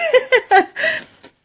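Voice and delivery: high squealing laugh; laughing at her own joke; burst of laughter. Real, not acted. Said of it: "I swear I'm not pregnant".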